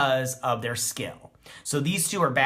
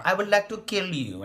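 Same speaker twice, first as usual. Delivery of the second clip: a light mock Doctor Evil voice, pitched higher; Doctor Evil accent; 6 semitones higher than usual. Reviewer imitates the character's odd Doctor Evil villain line. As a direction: lightly ham up a villain's menace. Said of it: "weird Doctor Evil, uh, accent".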